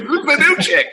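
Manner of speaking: high-pitched excited squeal; hammed-up high excited query; mock smitten crowd. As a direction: pipe it up high like an excited admirer. Acting guys excited by new girl.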